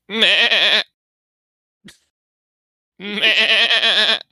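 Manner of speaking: loud, high sheep bleat. Acted. Sheep interrupting the players' reminiscing, loud high bleating. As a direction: bleat insistently like a sheep butting in. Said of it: "a sheep comes up to them".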